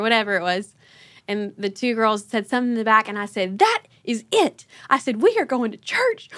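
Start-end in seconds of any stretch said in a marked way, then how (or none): 3.6-4.6 high-pitched mild mock yell. Fed-up mom to arguing girls in the car, mock yelling. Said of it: "while I'm yelling in the car". 5.2-6.3 high-pitched mild mock yell. Fed-up mom to arguing girls in the car, mock yelling. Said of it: "while I'm yelling in the car".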